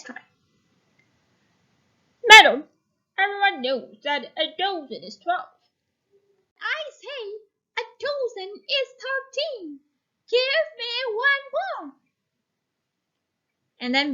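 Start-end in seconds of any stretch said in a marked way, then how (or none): 2.2-5.5 a lightly voiced stern baker, loud opening; baker's voice. Shown as a demonstration. Lightly voiced baker correcting the old woman. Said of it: "change our voice a little bit to show that the man is speaking". 6.6-11.9 put-on high, demanding old woman's voice. Shown as a demonstration. Old woman demanding a thirteenth cookie from the baker.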